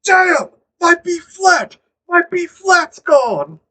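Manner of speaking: loud, high-pitched wail, drawn out; quoted anime outburst. Retold or imitated. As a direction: cry it out like a dramatic anime line. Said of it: "best line in the series".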